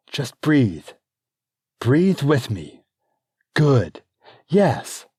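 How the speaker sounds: urgent birthing coach; coaching midwife. Acted. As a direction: coach her through the pain.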